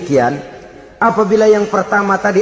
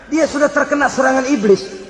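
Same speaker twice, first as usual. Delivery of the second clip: normal talking voice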